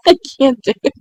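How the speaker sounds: can't hold back the laughter; laughing. Real, not acted. Vlogger failing at ASMR, real laughing. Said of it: "I keep breaking out in laughter".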